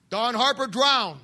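ordinary talk

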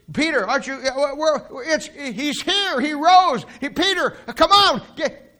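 very high, climbing, stammering; can barely get the words out; excited pleading. Acted. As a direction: disciple frantically urging Peter, high and loud. Friend pleads excitedly with Peter.

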